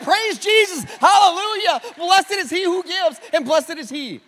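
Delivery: high, booming Pentecostal shout of praise; hammed-up hallelujah preaching, easing off at the end; Pentecostal preacher. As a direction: erupt into loud, high revival preaching. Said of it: "from pastor to Pentecostal".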